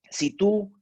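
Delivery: usual speaking voice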